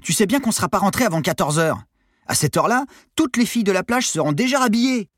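loud complaint climbing very high; protest, building to a high-pitched punchline; cheeky shorty. A narrator's reading. Short sailor pleading beach girls will leave, whining. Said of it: "répondit le nabo".

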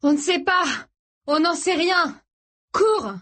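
high, urgent cries while running; loud, high, breathless urging; out of breath. A narrator's reading. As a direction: shout urgently to get her moving. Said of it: "à bout de souffle".

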